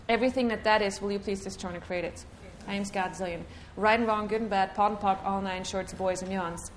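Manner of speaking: quick, hushed ritual recitation; softly rushed chanted formula; rattled-off clearing statement. Acted. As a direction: murmur the formula fast like a rote incantation.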